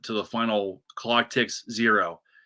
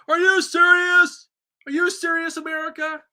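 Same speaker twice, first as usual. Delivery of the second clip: Dick Vitale impression; 5 dB louder than usual. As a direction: ham up an excited TV commentator. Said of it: "I was like Dick Vitale".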